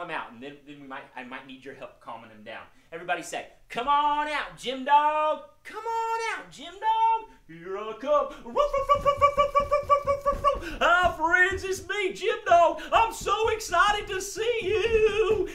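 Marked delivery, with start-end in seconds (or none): 3.7-7.3 loud, high, drawn-out call. Pretend. Host leads kids calling the dog out, high loud call. 7.5-15.5 high, loud barking puppet voice; overexcited dog. Pretend. Puppet dog greets the kids, wildly excited high voice. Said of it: "Jim gets so excited".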